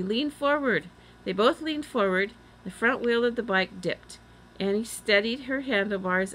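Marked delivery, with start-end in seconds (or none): 0.0-0.9 high-pitched urgent instruction; shouted instruction. A narrator's reading. Girl steering a flying bike down, shouting. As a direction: call out the move to your partner. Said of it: "shouted Annie".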